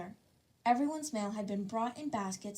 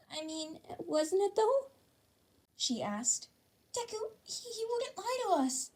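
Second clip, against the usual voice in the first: put-on high, hesitant girl's voice; high uncertain Uraraka voice; unsure girl; 11 semitones higher than usual. A narrator's reading. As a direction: waver as she talks herself round. Uraraka doubting, swayed.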